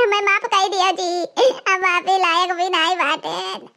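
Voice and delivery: tearful plea. Acted. Mother begging her son-in-law for forgiveness, slow and tearful. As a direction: plead slowly in shame.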